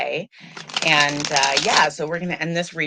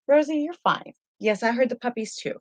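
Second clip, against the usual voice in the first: high sing-song voice soothing the dog; high, gentle dog-soothing voice; pet talk; 9 semitones higher than usual. Real, not acted. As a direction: coo at the dog so she settles. Reassuring her dog mid-stream.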